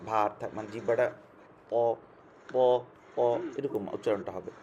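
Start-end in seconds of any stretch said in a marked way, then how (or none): 1.7-3.2 "প" heavy, with air held in the mouth; thick, mouth full. Shown as a demonstration. Teacher saying this is how to pronounce it, thick "প". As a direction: round out "প" thickly. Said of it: "মুখের মধ্যে বাতাস থাকবে".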